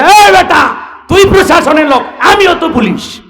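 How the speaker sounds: loud, high-pitched indignant challenge; angry confrontation. Acted. One policeman challenges another, angry and loud. Acted quarrel between two policemen.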